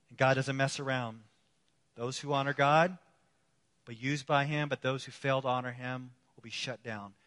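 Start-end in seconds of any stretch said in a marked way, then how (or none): none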